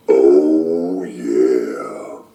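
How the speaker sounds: very low, growly oh yeah; low gloating monster voice; gross-out creature voice. Acted. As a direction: drop way down like a grouch relishing it. Character reacts to sandwiches.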